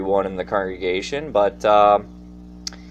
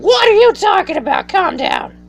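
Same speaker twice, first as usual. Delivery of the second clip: mock outburst; 5 dB louder than usual. Sudden high outburst, other voice.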